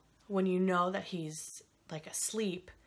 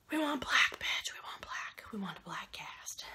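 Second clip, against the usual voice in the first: hissed, mostly unvoiced; fierce whisper; 3 semitones higher than usual. Mother retelling her hissed answer to the nurse, whispered. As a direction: whisper it through your teeth.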